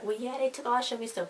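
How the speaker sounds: a hint of a breezy mom. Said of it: "Then the mom was like".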